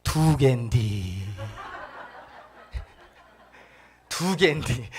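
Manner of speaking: put-on very slow Chungcheong accent, with a laugh; deadpan Chungcheong accent, dragged out slowly; 느릿한 충청도 사투리로 웃으며; Chungcheong punchline. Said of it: "이 충청도 사람이 뭐라 그런지 아세요".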